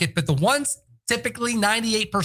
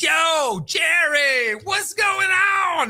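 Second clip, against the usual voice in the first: shouted, high, slow hype call; high excited shout; hyped football player; 9 semitones higher, much slower than usual. Retold or imitated. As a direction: yell it like a pumped-up athlete on the phone. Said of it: "he calls up and he says".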